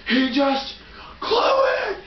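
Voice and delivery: high outraged yell; mock yell. Retelling the teacher's reaction, mock yelled. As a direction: shout it like a teacher catching a mistake. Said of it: "Mister Douglas goes".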